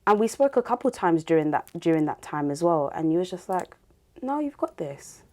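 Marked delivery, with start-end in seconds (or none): 4.2-5.2 high, soft, slow encouragement; imitated reassurance. Host quotes friend encouraging her, soft reassurance. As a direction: gently voice a friend's pep talk, pitched up.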